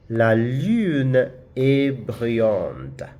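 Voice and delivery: very slow model French, stretching the U in "lune", "curé", "une", "brune", "sûr"; French U demonstration. Shown as a demonstration. Teacher reading practice sentences for learners, slow drawn-out French. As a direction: read each French phrase syllable by syllable, drawing out the U. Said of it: "pronounce the U in French".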